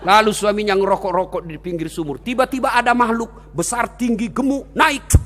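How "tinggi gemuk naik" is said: ordinary delivery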